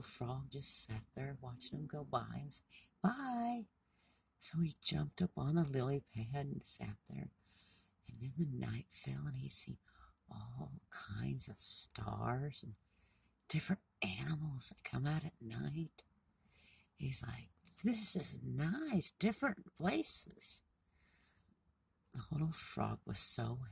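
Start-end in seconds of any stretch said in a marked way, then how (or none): 3.0-3.7 high, sing-out farewell; little frog's goodbye. Acted. Frog calls goodbye to departing deer. 17.8-20.6 high, slow wonder; delighted little frog. Acted. Frog admires night stars and animals.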